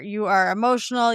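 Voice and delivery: ordinary talk, nothing marked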